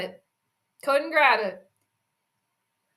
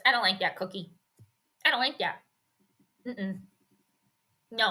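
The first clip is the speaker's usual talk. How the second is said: low, soft mock refusal; Kirk; 3 semitones lower, 6 dB quieter than usual. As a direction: quietly play someone turning down a treat, lower than usual. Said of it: "Kirk said".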